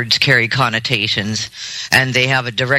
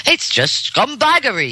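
higher-pitched mock Irish accent; imitated thick Irish accent; 10 semitones higher than usual. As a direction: put on a thick Irish brogue. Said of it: "He's got that thick accent".

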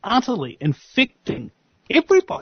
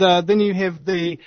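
ordinary delivery

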